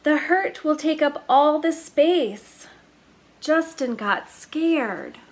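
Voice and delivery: plain reading voice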